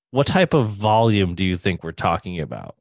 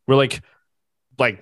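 usual speaking voice

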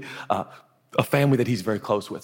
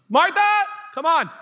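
high, loud call to a sister; 4 dB louder than usual. Acted. Mary calls Martha to join her, high excited call. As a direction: pitch jumps up, calling her over.

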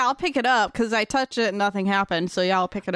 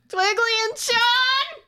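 high, slow call for friends; 12 semitones higher, slower than usual. Acted. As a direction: call out into the dark. Storm calls for friends.

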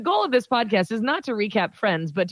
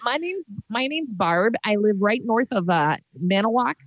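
chatty Wisconsin character voice; hammed-up upper Midwest local; upper Midwest accent. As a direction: introduce yourself in a folksy Wisconsin accent. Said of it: "a little upper Midwest".

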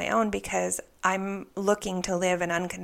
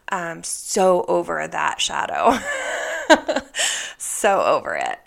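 fed up; 6 dB louder than usual. Real, not acted. Fed up after crying.

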